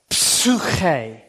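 Psuche with a breathy, drawn-out ps and ch; exaggerated. Shown as a demonstration. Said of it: "Slowly, exaggerate".

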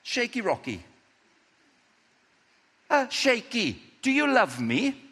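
Jesus gently pressing Peter; Jesus asking. Acted. Jesus asking Peter, lifted and pleading.

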